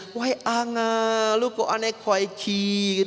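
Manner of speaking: high, slow, drawn-out fake wail; mock wailing widow. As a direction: hams up a grieving wife's lament over the body. Istri meratapi suami pura-pura mati. (ratapan pura-pura, tinggi dan lambat)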